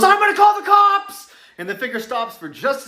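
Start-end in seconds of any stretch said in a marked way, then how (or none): 0.0-1.3 frightened shout. Retold or imitated. Girl threatens intruder at window.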